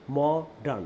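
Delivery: modern split into mo-dern; teacher breaking modern in two; syllable by syllable. Shown as a demonstration. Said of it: "দেখেন, দুইটা syllable".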